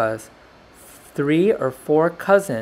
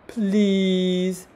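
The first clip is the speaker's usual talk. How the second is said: long, high begging "please"; pleading; 6 semitones higher than usual. A narrator's reading. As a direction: beg with a drawn-out, raised voice. Dog begs hesitant pencil.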